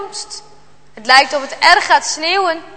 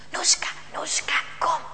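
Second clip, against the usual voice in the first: soft whispered call; 7 dB quieter than usual. A narrator's reading. Jarno whispers to the dog at night, hushed. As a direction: whisper the dog's name so nobody wakes.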